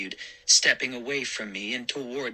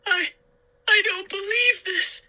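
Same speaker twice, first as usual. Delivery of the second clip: very high, small frightened voice; small scared disbelief; tiny voice; 3 dB louder than usual. A narrator's reading. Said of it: "she cried in a tiny voice".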